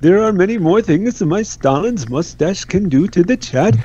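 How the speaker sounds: a creepy, wandering bad Indian accent; deliberately clumsy Indian accent to creep out the chat; mock Indian accent. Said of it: "That accent is all over the place".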